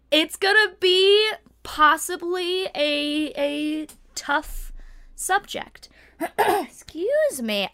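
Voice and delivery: just-woke-up morning voice; rough morning voice, just out of bed. Real, not acted. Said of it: "I have morning voice basically right now".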